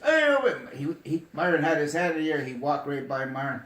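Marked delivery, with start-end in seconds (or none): 0.0-0.5 high, loud mock call to stop him. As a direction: shout after him as he walks by. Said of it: "And then [name] said".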